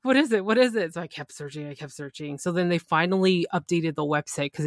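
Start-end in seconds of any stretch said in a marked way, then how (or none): 0.0-0.9 high, loud frantic "what is it"; panicked disbelief, pitched up; retelling her frantic search; frantic excitement. Retold or imitated. Frantic retold reaction.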